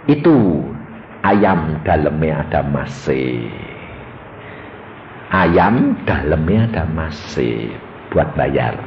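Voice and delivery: low, very slow, deliberate pronouncement; voice of God. Retold or imitated. God telling the preacher where the money is, very slow. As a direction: speak it slowly, like a divine revelation. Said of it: "Tuhan ngomong".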